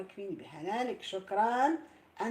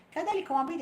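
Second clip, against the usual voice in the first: excited greeting; 8 semitones higher, 6 dB louder than usual. Real, not acted.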